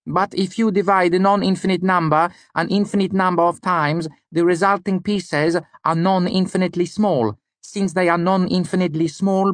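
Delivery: put-on high voice in an odd Lanati accent. A narrator's reading. Student reasoning about infinity, odd Lanati accent. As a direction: explain it in a high, foreign-sounding Lanati accent. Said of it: "in his odd Lanati accent".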